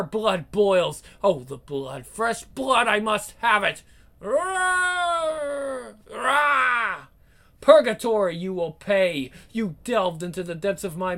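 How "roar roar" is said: high, shouted monstrous roars; beast roars. Acted. Blood-crazed creature charging her prey, high roars. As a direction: let the bloodlust roar out.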